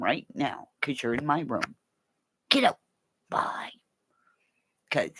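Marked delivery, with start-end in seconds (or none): none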